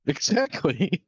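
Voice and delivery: slow, laughing emphatic exactly. Real, not acted. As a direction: stretch it out while laughing. Laughing repeat, drawn out.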